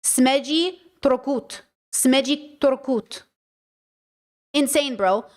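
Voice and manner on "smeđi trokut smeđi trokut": Smeđi trokut sounded out slowly; slow Croatian. Retold or imitated. Vlogger mock-struggling with Croatian words, slow. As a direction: plod through Smeđi trokut. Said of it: "And I'm like".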